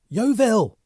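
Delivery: high, loud frantic shout. Acted. Bunny shouting to Yeovil across the room, high and loud. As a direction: call out desperately. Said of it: "Bunny shouted".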